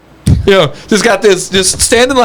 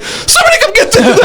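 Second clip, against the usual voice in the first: high, frantic plea through laughter; mock-terrified squeal; panicked call for help; 13 semitones higher than usual. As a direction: beg someone to come get it, laughing. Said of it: "she was freaking out".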